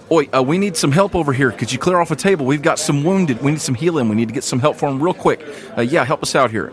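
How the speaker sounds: a guard hurrying to get the wounded healed; urgent guardsman. Acted. GM voices guardsman.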